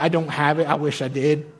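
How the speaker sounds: ordinary talk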